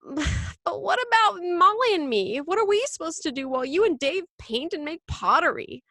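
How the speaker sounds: high-pitched, indignant objection; protesting child. Acted. Michael objecting to the move.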